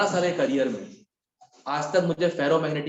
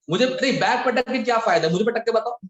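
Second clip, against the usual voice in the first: higher, louder playful dare; teasing challenge; 7 semitones higher, 5 dB louder than usual. Real, not acted. Teacher teasing a student slamming her bag, loud playful dare. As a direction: tease her, pitched up and loud.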